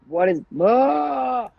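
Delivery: slow, rising startled cry; mock startled cry. Telling the therapist about her panic, startled cry. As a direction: act the shock of realising. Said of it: "but now I'm like".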